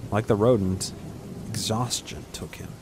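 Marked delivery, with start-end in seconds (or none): none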